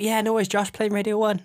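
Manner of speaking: a friendly eager Alexa; cheerful Alexa. Retold or imitated. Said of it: "It's like".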